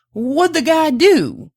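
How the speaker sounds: high, loud, drawn-out question; eager curiosity. A narrator's reading. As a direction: stretch it out in wide-eyed curiosity. Prospector curious about the captive.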